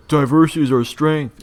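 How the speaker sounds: high, loud mocking chant; mocking slogan shout.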